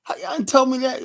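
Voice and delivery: very high squeak of disbelief; shocked kid. Retold or imitated. A five-year-old hearing his family was on welfare, high shock. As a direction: gasp it out way up high, stunned.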